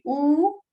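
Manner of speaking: a long rounded oo for "u". Shown as a demonstration. Teacher showing learners the wrong long vowel. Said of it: "we don't say".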